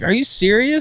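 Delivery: high, incredulous doctor; mock doctor, shocked by the wound. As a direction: sound stunned at what you see.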